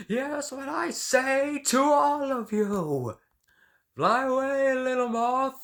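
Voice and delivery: screamed very high and very loud; furious outburst. Acted. Artist screaming at his doctors, furious shrieking outburst. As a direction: rage at all the doctors. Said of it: "apologize for that outburst".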